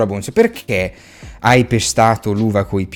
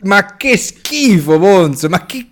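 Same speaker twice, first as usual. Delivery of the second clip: disgusted; 12 semitones higher, 5 dB louder than usual. Real, not acted. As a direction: recoil at the thought of it. Disgust at crushed grapes.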